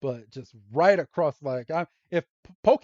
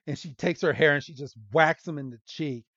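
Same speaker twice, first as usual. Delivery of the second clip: usual speaking voice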